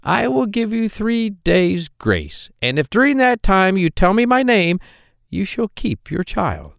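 a droll little man, sly and teasing; hobgoblin voice. Acted. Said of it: "and he said".

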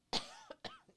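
sharp cough mid-sentence; real cough, then clear the throat; coughing fit. Real, not acted. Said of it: "Excuse me".

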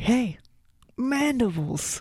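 high, slow jokey suggestion; mock James Cameron. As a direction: drawl it like a goofy pitch. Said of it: "saw Predator and was like".

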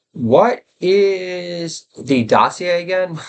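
slow mock-confused bro asking; Joe Rogan impression. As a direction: drag out the question as if baffled. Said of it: "Funniest thing Joe Rogan's ever done on his podcast".

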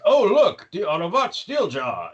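lightly hammed-up show voice; mock announcer cheer. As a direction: call out the name with fake pep. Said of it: "they're like".

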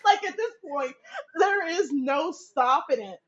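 talking through laughter; giggling, can't stop; real laughter breaking through; laughing. Real, not acted. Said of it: "laugh cry".

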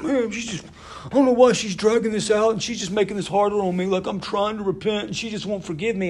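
high-pitched mock whine of a put-upon husband; complaining husband. Husband venting about his wife during a counseling break, mock exasperated complaint. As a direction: imitate an exasperated husband griping, pitched well up. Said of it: "He's like".

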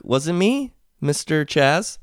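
put-on high, innocent kid; lightly voiced innocent child. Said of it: "every single kid looks at me like".

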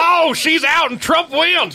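very high, drawn-out announcer shout; slow, booming hammed-up game call; Harry Caray. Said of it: "just doing Harry Carey all night".

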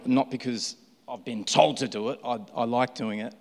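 ordinary delivery

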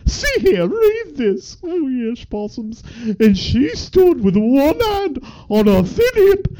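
high, swooping theatrical voice; theatrical reader character. Acted. Performing a story passage for the co-host, high theatrical character voice. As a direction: read the story aloud in a high, put-on character voice.

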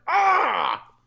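high, imitated scream of agony; hammed-up cry of pain; mock pained scream. Retelling how he screams putting on the crown, mock pained scream. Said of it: "he screams in agonizing pain".

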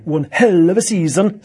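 loud Scandinavian accent. Real, not acted. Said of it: "I felt myself breaking into a Scandinavian accent there".

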